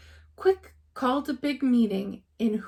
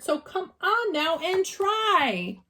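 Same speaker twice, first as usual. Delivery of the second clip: slow, higher gentle urge; pleading encouragement; 7 semitones higher, slower than usual. A narrator's reading. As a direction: coax them gently. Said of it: "call to the mayor".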